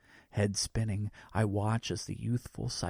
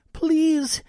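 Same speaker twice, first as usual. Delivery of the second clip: very high, loud begging cry; desperate plea; 9 dB louder than usual. A narrator's reading. Boy sinking in icy water, high desperate begging. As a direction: beg for your life. Drowning boy pleads for help.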